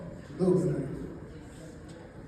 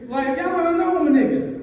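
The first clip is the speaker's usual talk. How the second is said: high excited punch; 8 semitones higher, 5 dB louder than usual. Real, not acted.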